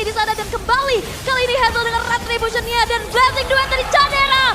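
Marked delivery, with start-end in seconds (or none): 1.2-4.5 esports caster. Real, not acted. Teamfight play-by-play hype.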